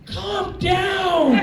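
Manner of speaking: high, drawn-out plea shouted; acted-out flustered holler; exasperated shout. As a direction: shout it at an over-eager airman. Flustered supervisor answers airman's yell.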